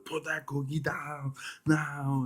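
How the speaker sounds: hammed-up Austrian accent, each word slow; Austrian Arnold yell. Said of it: "crazy Austrian man yelling at the phone".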